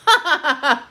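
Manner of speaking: high, loud bursting laugh; burst of laughter. Real, not acted. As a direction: crack up out loud. Laughs at her nail mishap.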